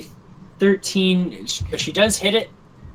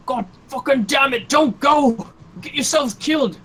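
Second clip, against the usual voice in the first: high, exasperated acted yell; frantic angry shout; 8 semitones higher than usual. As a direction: shout after someone running away. Acted character shouts after fleeing companion.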